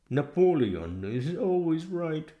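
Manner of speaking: Boxer's maxim. Acted.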